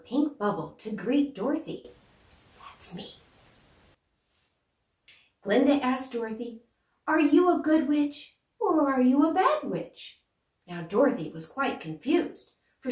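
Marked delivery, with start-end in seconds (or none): 2.6-3.2 put-on a quick secret whisper to the kids; conspiratorial whispered aside; playful whisper. Narrator whispers aside as herself. 7.1-10.2 higher, sing-song good witch voice; lilting, higher storybook witch; Glinda the Good Witch. Acted.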